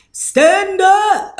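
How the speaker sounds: high, loud hype shout; excited cheer. Real, not acted. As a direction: hype up the donor like a crowd. Hype cheer for donor Lamar.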